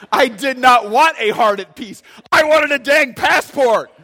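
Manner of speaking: loud, high mock-angry outburst; mock outrage. To the congregation, mock fury over the monk's reply: high, loud shout. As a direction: shout it in comic indignation. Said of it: "it made me so mad".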